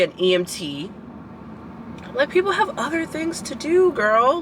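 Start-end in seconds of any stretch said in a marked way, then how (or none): 2.1-4.4 high, pitched-up exasperated plea. Real, not acted. Venting to viewers about classmates, exasperated and high. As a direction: throw it up high in disbelief.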